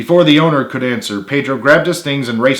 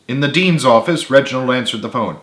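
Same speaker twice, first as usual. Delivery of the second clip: usual speaking voice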